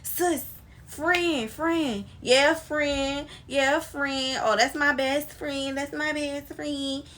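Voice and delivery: high, sugary fake-friend gushing; imitating the over-eager user friend; mock fake friend. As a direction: gush like a clingy fake friend. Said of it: "them the ones that be like".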